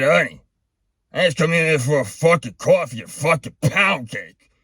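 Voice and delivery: hammed-up Brooklyn rant shouting at the cashier; ranting outburst. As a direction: blow up over the price like the webcam ranter. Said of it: "That was the whole rant".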